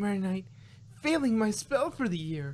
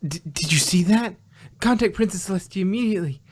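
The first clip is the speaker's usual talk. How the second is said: louder, fast and urgent; frantic; 8 dB louder, faster than usual. Acted. Twilight urging Spike to call Celestia, frantic. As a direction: demand help in a panic.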